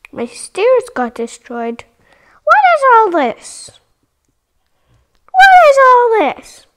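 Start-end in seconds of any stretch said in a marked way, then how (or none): 2.4-3.7 high, loud disbelief; surprised. Real, not acted. Surprised at destroyed stairs, first take. 5.3-6.7 even louder, high disbelief; shocked shout. Real, not acted. Surprised, louder second take.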